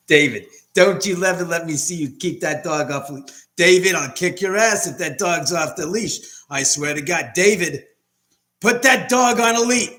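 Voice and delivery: angry neighbor yelling. Retold or imitated. Neighbor yelling at David to leash the dog, hammed-up furious shout. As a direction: bark the threat like an outraged neighbor.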